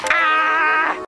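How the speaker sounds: sustained full-volume yell; loud scream. Acted. Engine in trouble, long loud scream. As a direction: let out a long holler.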